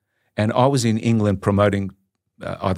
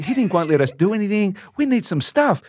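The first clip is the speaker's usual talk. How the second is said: high, insistent retold begging; urgent pleading; 10 semitones higher than usual. Player stuck in camp begs friend for tapes, urgent. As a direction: plead like someone going stir-crazy.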